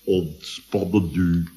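low, slow, drawn-out hypnotic drone; sombre hypnotist. Retold or imitated. Patient mimicking his hypnotherapist's relaxation patter, sombre and slow. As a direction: intone it like a gloomy stage hypnotist. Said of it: "begeleid door een somber".

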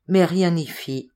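ordinary delivery, nothing marked

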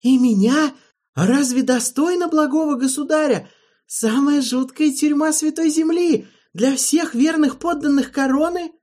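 high, loud tormented protest; anguished plea. A narrator's reading. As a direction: plead in despair, voice straining. Holland's anguished protest continues.

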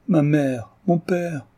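high, anguished cry to her parents. A narrator's reading. Daughter at her mother's feet, calling out in anguish. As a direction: throw it out as she falls at their feet.

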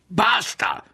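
high, loud cry of Basta!; a sharp, loud Basta!; outburst shout. A narrator's reading. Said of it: "fu sul punto di gridare".

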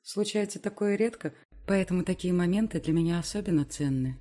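plain reading voice

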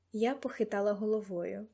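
ordinary delivery, nothing marked

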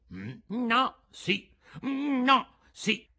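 high, stubborn back-and-forth; bickering. A narrator's reading. Two old men contradict each other, high stubborn bickering. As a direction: trade contradictions in high character voices.

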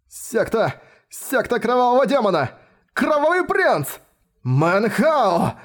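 high, panicked shouting; terrified cry. Acted. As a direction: cry out in sudden terror. Pursuers cry out in terror.

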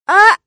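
sudden loud high yelp of surprise; startled high cry. A narrator's reading. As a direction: jump and cry out. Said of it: "воскликнула я". (испуганный вскрик)

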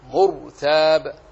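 "مرتاب" drawn out, loud, ر held heavy. Shown as a demonstration. Teacher repeating the example, slow "مرتاب" with heavy ر. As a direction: say "مرتاب" slowly with a thick ر. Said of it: "فخمت".

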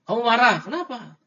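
high-pitched taunting question; mock challenge. Teacher challenging an imagined slapped opponent, mock surprise.

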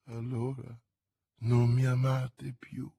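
a touch of sadness, understated; mild sad reproach; slow and sad. A narrator's reading. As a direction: say it slowly, mildly wounded. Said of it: "disse adagio e con tristezza".

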